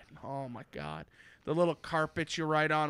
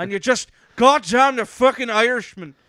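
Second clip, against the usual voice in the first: loud, high, hammed-up Scottish bluster; rough Scottish accent; 7 semitones higher, 12 dB louder than usual. Shown as a demonstration. Mock-cursing the Irishman while demonstrating, rough Scottish accent. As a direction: belt it out in a throaty Scottish brogue. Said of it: "Scottish is a little rougher".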